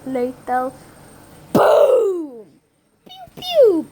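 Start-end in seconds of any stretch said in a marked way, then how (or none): 1.5-2.5 loud high boom with a falling tail; big mouth-made boom, high and loud; imitated explosion. Shown as a demonstration. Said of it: "booms and pew pews and smaller explosions". 3.0-3.9 very high, loud falling pews; high mouth-made zaps; imitated pew pews. Shown as a demonstration. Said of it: "booms and pew pews and smaller explosions".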